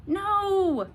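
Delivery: high, loud protest; child refusing the sad ending; girl protesting. A narrator's reading. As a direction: cry out against the ending. Abilene protests.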